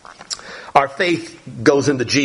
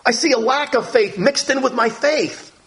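humble plea; 8 semitones higher than usual. Retold or imitated. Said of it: "Just a humble man, a simple man".

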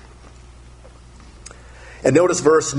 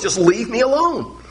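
mild mock anger; 7 semitones higher than usual. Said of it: "That's how God feels".

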